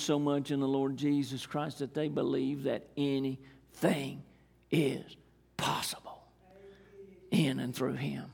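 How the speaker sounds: whispered anything is possible; hushed sermon climax. Acted. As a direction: drop to a breathy hush on possible. Preacher drops to a hush.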